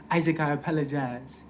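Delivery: put-on African-accented father, smoothly spoken. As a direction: have another go at the African dad's smooth voice. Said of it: "He had a real smooth voice".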